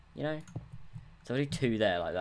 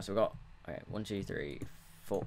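normal talking voice

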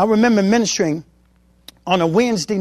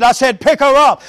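a touch louder, firm command; lightly voiced God insisting; voice of God with authority; 4 semitones higher, 6 dB louder than usual. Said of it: "God came to me again with authority".

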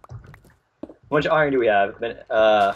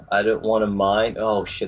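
ordinary talk, nothing marked

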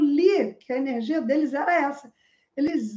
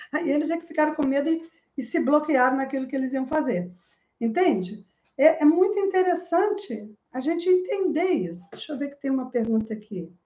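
ordinary talk, nothing marked

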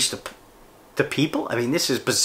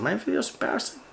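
high-pitched, softer echo of disbelief; feigned astonishment, pitched way up; mock incredulous; 10 semitones higher, 6 dB quieter than usual. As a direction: repeat the accusation as a high, incredulous question. Throws a critic's comment back, incredulous.

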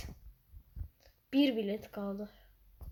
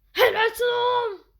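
higher, louder, slow praise; loud cheer; 11 semitones higher, 9 dB louder than usual. Real, not acted. Gamer cheering a teammate's great play, loud slow praise.

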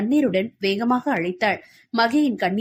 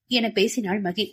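ordinary delivery, nothing marked

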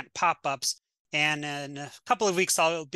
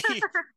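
laugh; 3 dB louder than usual. Real, not acted. Laughs mid pretend pitch.

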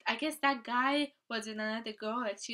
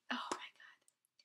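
soft whisper; 8 dB quieter, slower than usual. Real, not acted. Whispered awe.

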